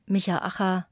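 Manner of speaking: ordinary talk, nothing marked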